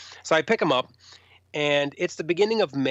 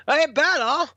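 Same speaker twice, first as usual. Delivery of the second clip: higher, louder, gruff warning; 13 semitones higher, 5 dB louder, slower than usual. Retold or imitated. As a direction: growl it as a mock threat. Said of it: "He goes".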